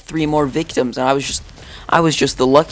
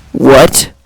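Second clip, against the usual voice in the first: loud, rising disbelief; shocked teacher; 9 dB louder than usual. Retold or imitated. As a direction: imitate a teacher caught off guard, loudly. Teacher shocked at choking news.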